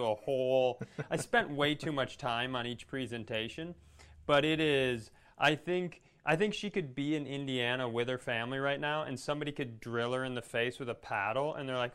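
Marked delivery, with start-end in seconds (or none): none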